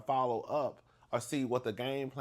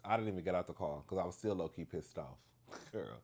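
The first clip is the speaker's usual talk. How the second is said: ordinary talk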